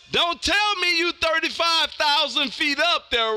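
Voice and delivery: ordinary talk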